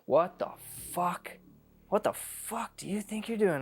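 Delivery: high, outraged yell of disbelief; retold angry clerk. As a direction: act out an irate shopkeeper's outburst. Acting out angry store clerk confronting him.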